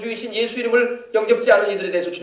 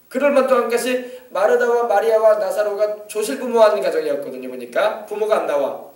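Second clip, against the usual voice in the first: ordinary talk, nothing marked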